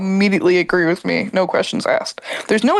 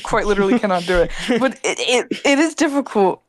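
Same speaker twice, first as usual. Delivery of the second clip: ordinary delivery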